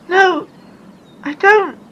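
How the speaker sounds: high, urgent and breathless; panicked child pointing at the smoke; frightened girl. Acted. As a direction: hurry him before it erupts. Anne answers Luffy.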